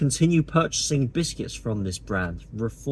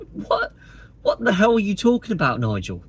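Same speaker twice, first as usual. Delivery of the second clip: higher, incredulous what-the-hell; Jackie Chan meme; 6 semitones higher than usual. Retold or imitated. Vlogger mocking Farage like the meme, baffled disbelief. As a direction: throw up your hands in baffled disbelief. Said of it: "reiterating the Jackie Chan meme".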